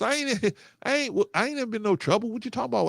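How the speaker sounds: half-laughing mock protest; indignant disbelief. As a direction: pitch up in retold outrage. Retold protest to the cop.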